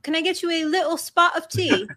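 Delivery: British accent. Shown as a demonstration. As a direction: offer it like a mock Brit. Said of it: "Can you guys do a British accent?".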